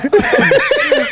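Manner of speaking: high, loud burst of laughter. Real, not acted. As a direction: laugh hard. Laughing at the story. (carcajada fuerte y aguda)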